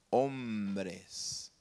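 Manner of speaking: "hombres" low, soft and drawn out; "hombres" stretched on a deep falling tone; weighty final word. Shown as a demonstration. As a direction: sink slowly onto "hombres". Drawn out after dramatic pause.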